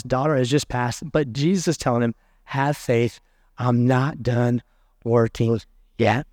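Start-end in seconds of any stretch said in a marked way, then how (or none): 2.4-6.3 calm reassurance. Acted. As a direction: reassure him there's more to come. Jesus reassuring father.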